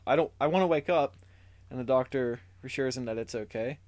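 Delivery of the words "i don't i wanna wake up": louder, higher panicked cry to wake up; retold yell. Barney under hypnosis cries out to the doctor, in a panicked yell. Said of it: "he suddenly yells".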